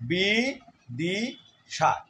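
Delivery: বিদিশার stretched out very slowly. Shown as a demonstration. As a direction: crawl through বিদিশার syllable by syllable. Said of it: "আবার আস্তে উচ্চারণ করলেও".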